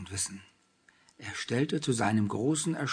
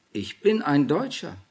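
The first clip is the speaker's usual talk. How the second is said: confident, higher and louder declaration; self-assured; 5 semitones higher, 7 dB louder than usual. A narrator's reading. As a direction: state it proudly, with a firm push. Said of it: "antwortete der Junge selbstbewusst".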